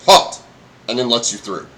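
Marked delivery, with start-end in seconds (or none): 0.1-0.4 loud, higher barked halt; shouted halt. Acted. Goblin guard halts each traveller with a loud bark. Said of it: "he goes".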